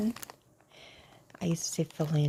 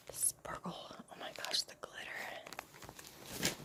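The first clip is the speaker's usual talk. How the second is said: soft unboxing whisper; hushed show-and-tell whisper; whispered ASMR; 5 dB quieter than usual. Real, not acted. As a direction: breathe the words close to the mic.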